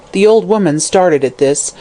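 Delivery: plain reading voice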